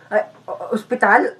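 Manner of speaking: weakly stammered, faked illness; feigned sick voice. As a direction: catch yourself and sound ill. Faker recovers sick voice after slip.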